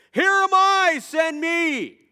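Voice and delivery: very high, loud eager cry; excited. Retold or imitated. Isaiah answering God, crying out with excitement. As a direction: shout it out, ready to go. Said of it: "he's filled with excitement".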